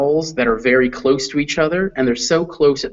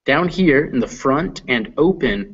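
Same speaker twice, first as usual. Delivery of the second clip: normal talking voice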